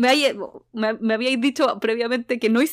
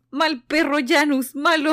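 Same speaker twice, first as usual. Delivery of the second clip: loud, higher scolding of a bad dog; scolding shout; 5 semitones higher, 5 dB louder, slower than usual. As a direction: yell at the dog like a child scolding it. [name] regaña al perro. (regaño a gritos al perro)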